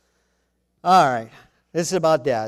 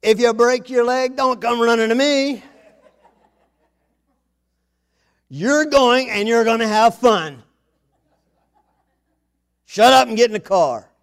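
louder, higher mock scolding; mock dad scolding; 6 semitones higher, 9 dB louder than usual. Father ordering the family into the car, loud mock scolding. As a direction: snap at the kids like an impatient father. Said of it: "to say the darndest things".

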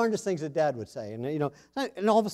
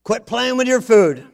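louder, slow dinner-table scolding; mock dad scolding; 6 semitones higher, 9 dB louder than usual. Father scolding a child at the dinner table, loud mock scolding. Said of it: "to say the darndest things".

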